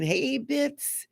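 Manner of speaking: high, twangy grandpa calling his puppy; imitating fond Grandpa. Reader recalling grandpa calling his favorite puppy, imitated twang. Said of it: "I always just hear his voice".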